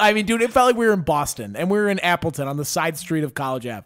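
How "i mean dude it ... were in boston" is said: ordinary talk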